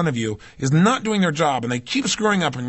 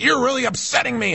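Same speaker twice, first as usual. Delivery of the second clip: mock scream; 7 semitones higher than usual. Someone confronts you, upset with you, in a mock scream. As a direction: act out a mild mock yell. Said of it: "they scream at you".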